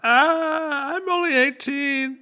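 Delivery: high, pinched mock-old voice; old-looking dockworker. Dockworker joking to the party, high put-on old voice. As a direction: croak out your young age.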